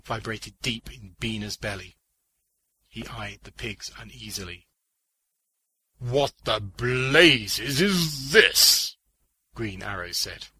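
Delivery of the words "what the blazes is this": loud, very slow exclamation; baffled outburst, each word drawn out; astonished hero. Acted. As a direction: stretch it out in loud amazement. Green Arrow astonished by bronze pigs.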